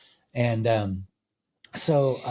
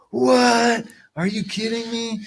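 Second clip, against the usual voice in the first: imitating amazed riders, much higher and louder; 13 semitones higher, 9 dB louder, slower than usual. Chairlift riders reacting to the sandwich, an imitated amazed yelp. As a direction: squeal it out like someone stunned by a treat.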